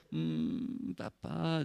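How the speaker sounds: low, very soft groggy mumble; sleepy son. Acted. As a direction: groan to dad from half-sleep. Son answers from his sleep.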